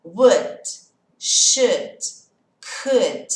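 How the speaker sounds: slow firm drill, L silent in "Would, should, could"; silent L, emphatic. Shown as a demonstration. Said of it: "O L é mudo".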